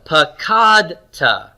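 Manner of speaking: "Pakadta" very slowly, stretched KAD; non-final stress. Shown as a demonstration. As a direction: lean on KAD in "Pakadta", then a light ta. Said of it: "it's not final syllable stress".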